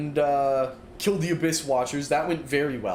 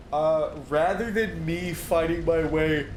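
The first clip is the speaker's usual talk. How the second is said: yawning. Real, not acted. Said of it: "I don't know why I'm yawning so much".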